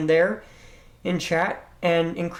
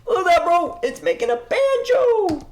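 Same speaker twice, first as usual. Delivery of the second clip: excited; 12 semitones higher, 3 dB louder than usual. Real, not acted. Excited about banjo sound.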